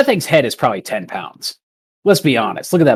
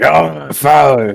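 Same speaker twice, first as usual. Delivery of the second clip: slow, louder giant voice; slow drunken giant; 4 semitones higher, 4 dB louder, slower than usual. Acted. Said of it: "he's like".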